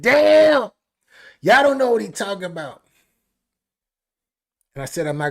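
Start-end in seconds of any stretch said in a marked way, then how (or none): 0.0-2.8 higher, louder exclamation; excited outburst. Real, not acted. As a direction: blurt it out hyped. Hyped exclamation.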